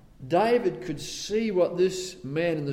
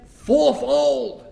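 slow, loud and high judgement; slow stern ruling from the king; stern decree; 7 semitones higher, 8 dB louder, slower than usual. Acted. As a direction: pronounce the penalty with force. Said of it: "And he says".

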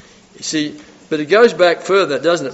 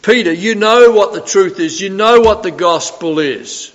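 raised, higher voice, pleading the point; emphatic confrontation; 4 semitones higher, 6 dB louder than usual. Acted. Preacher as Paul confronting Peter over the gospel, emphatic confrontation. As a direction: confront him loudly and insistently.

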